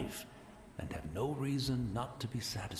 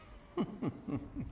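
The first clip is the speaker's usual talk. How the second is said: rising chuckle; 9 semitones higher, 3 dB quieter than usual. Real, not acted. Joking about growing befuddled.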